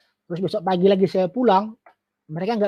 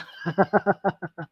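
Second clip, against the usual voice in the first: amused laugh. Real, not acted. Speaker joking about why they wouldn't touch it, amused laugh. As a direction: laugh it off lightly. Jokes about their reluctance.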